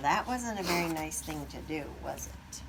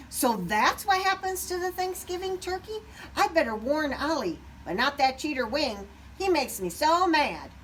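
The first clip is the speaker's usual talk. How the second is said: upset Cassie; 7 semitones higher, 4 dB louder than usual. A narrator's reading.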